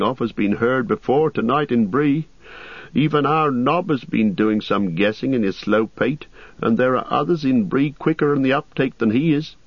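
innkeeper Butterbur. Acted. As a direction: chatter like a nervous landlord.

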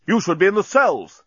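high, put-on indignant captain; snapped. A narrator's reading. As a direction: snap it as an outraged captain. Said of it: "snapped Bolfas".

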